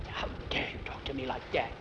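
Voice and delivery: hushed, voiceless protest; indignant whisper. Acted. Boy answers friend at a silent table, indignant whisper. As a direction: whisper it as if affronted. Boy retorts under the teacher's nose.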